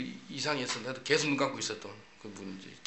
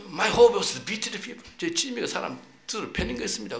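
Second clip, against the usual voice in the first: preaching testimony; 7 semitones higher, 3 dB louder than usual. Retold or imitated. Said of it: "그러면서 하니까".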